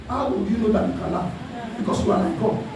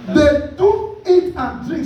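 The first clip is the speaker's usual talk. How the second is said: slow emphatic repeat; much slower than usual. Shown as a demonstration. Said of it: "repeats verse slowly".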